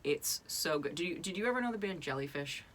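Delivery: ordinary talk, nothing marked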